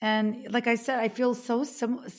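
quietly crying, voice catching; choked up. Real, not acted. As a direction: talk through genuine crying. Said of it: "I'm crying".

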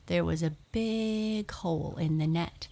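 ordinary delivery, nothing marked

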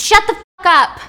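full enraged scream. Acted. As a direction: scream at the machine to stop. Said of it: "One of the guys screams".

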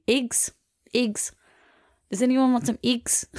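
high-pitched mock New Zealand accent. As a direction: imitate a Kiwi, vowels squeezed tight. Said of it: "I do really like a New Zealand accent".

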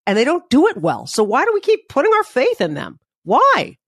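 rising pitch, exasperated; voice rising upset. Real, not acted. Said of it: "my voice goes up when I get upset".

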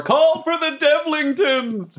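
high, drawn-out rallying yell; in-character battle call; acted yell. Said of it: "I just yell over".

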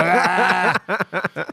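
high comic bellow, imitated; hammed-up scream for help; wounded buffalo bellow. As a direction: wail like a wounded animal for laughs. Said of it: "we thought there was a wounded buffalo".

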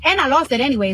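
ordinary talk, nothing marked

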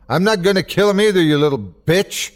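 high, fast, angry outburst; angry scream. A narrator's reading. Mister Everett yelling at the little creature, angry. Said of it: "Mister Everett screamed".

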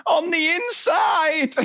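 mock sobbing kid. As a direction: wail it like a heartbroken child. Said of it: "And he's".

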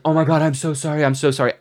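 high, fast, flustered sorry; frantic apology. Retold or imitated. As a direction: blurt out apologies in a panic. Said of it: "was like".

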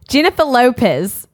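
party-trick New Zealand accent. Shown as a demonstration. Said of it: "like, in Australian or New Zealand".

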